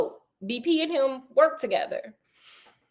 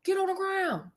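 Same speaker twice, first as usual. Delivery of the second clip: mock cop order; 7 semitones higher, 7 dB quieter, slower than usual. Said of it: "And so he's like".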